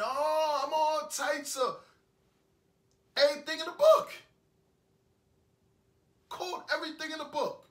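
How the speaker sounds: loud, high-pitched outburst; outraged rant. Acted. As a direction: blow up at the double standard, drawing words out. Imagines being blamed for the breakup.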